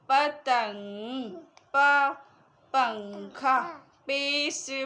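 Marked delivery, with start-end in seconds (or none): 1.7-2.1 पा called out loud and crisp; loud letter sound. Shown as a demonstration. Teacher to young learners, sounding out पे: loud पा. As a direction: pop a loud पा for the class. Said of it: "पे से पंखा".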